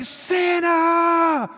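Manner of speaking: ecstatic high-pitched shout, held long; Buddy the Elf. Retold or imitated. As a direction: come unglued with joy, imitating an overexcited elf. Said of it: "He comes unglued".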